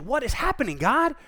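high, pleading, bewildered call to God; confused cry. Retold or imitated. Job crying out to God in confusion, high and pleading.

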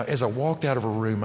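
ordinary talk, nothing marked

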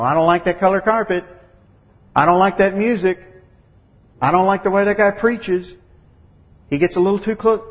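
whiny church member griping; petty complaining. Retold or imitated. Preacher mimicking petty churchgoers griping about carpet and music. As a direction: gripe like a picky congregant.

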